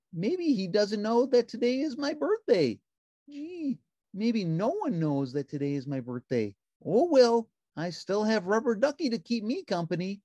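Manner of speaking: high, bright puppet voice; cheerful little puppet; Ernie voice. A narrator's reading. Narrator voicing Ernie answering the phone, high puppet voice.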